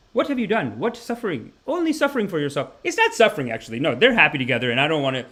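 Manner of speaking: ordinary talk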